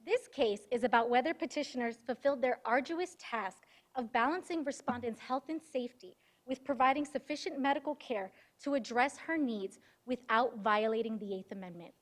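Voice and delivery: usual speaking voice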